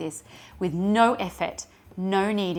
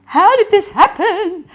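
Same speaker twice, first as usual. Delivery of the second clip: amazed; 11 semitones higher, 9 dB louder than usual. Retold or imitated. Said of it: "I go".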